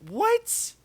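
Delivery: high-pitched stunned what; shocked yelp of disbelief; incredulous what. Real, not acted. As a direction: squeak it out in disbelief. Disbelief at mustard on biscuits.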